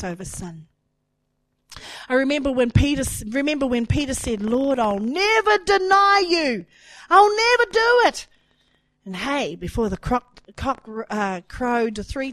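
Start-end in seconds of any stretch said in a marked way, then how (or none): 4.4-8.3 high, loud impassioned declaration; emphatic vow. Acted. Preacher as Peter promising the Lord, loud fervent vow. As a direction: play a fervent disciple swearing loyalty, voice raised high.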